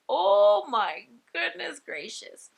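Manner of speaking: loud, slow, high exclamation of dismay; taken aback. Retold or imitated. Onlooker reacting to a child yelling, taken aback. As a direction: draw it out, high and loud, astonished.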